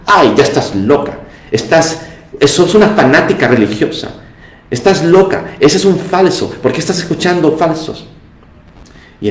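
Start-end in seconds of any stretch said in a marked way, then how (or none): 0.1-8.1 higher-pitched mock scolding husband; esposo indignado imitado. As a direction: scold her in a raised, mocking imitation of a husband. Esposo que recrimina a su esposa. (esposo que regaña, imitado con voz aguda)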